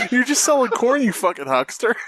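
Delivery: ordinary talk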